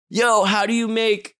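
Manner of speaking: high, slow incredulous online heckler; mocking internet commenter. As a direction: sneer it like a snarky fan comment. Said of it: "the comments will be like".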